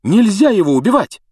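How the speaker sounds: high, loud, urgent protest; breathless cry. A narrator's reading. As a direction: cry out to stop them, out of breath. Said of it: "задыхаясь". (громкий высокий задыхающийся выкрик)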